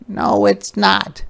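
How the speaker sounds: high, slow insistent cry; a touch of a girl's shout; mild shout. Acted. Ruthie shouts back at Martin, a mild, high, slow shout. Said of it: "shouted Ruthie".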